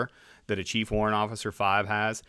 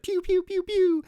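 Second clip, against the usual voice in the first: cartoon gunfire sound effect; high-pitched pew-pew noises; 4 dB louder than usual. Describing cartoon helicopter shooting, high-pitched pew sounds. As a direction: make high, childlike laser-gun noises.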